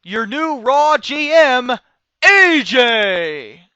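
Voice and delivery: big announcer bellow, slow and high; loud mock ring-announcement; Vince McMahon. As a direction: announce it to the arena, stretching the name. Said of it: "Vince says".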